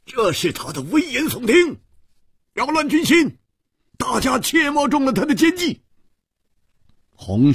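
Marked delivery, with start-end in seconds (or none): 0.1-5.8 high-pitched urgent shout to the troops; loud cry. A narrator's reading. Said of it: "忽然大声地喊". (大声高喊，警告众人)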